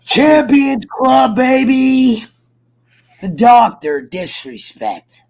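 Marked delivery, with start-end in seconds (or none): 0.0-2.2 loud, slow and high hype call; hyped. Real, not acted. Bragging to his friend, loud slow hype. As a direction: crow it out like a champion.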